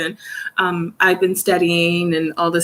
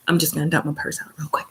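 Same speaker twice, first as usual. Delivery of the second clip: whisper; 5 semitones lower, 6 dB quieter, much faster than usual. Real, not acted. Rummaging, talking to herself.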